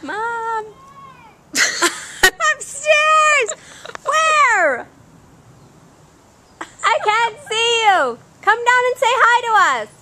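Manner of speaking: calling upstairs. Real, not acted. Mom calling up the stairs to her child, high and loud.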